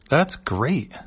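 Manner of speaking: high, lightly voiced worm alien. Worm alien reply in comic.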